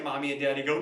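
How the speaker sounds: ordinary talk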